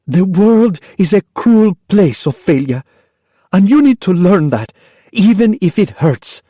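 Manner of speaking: high, sharp, impatient voice; mother impatient with daughter. Acted. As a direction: cut her off sharply. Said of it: "sharp with impatience".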